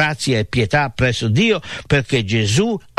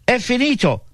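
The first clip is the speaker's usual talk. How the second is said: mock cry, pitched far up then falling; retold dying cry; 12 semitones higher than usual. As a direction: imitate a final cry with restraint. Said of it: "con l'ultimo rantolo della sua voce gridò".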